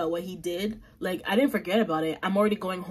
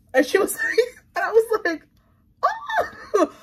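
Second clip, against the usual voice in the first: high, laughing, near crying; laughing squeal; 13 semitones higher, 4 dB louder than usual. Retold or imitated. Retelling the cafe lady's reaction, laughing and squealing high.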